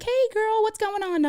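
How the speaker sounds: high, bright-voiced phone hello; imitating the chirpy office manager; cheery friendly greeting. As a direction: squeal it like a happy receptionist greeting a friend. Office manager Ginny answers phone.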